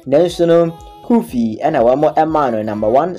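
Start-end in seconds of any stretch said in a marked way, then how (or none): none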